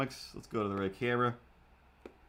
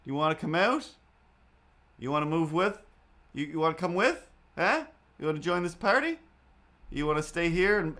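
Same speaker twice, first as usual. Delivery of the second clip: high coaxing pet-talk, raised and louder; calling the cat; 5 semitones higher, 4 dB louder than usual. Retold or imitated. Owner calling the hidden cat Ripley, high coaxing voice. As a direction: sweet-talk a hiding cat, higher and louder.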